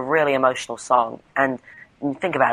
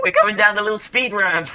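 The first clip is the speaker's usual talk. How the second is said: excited; 7 semitones higher than usual. Pretend. Narrating the ride, still excited.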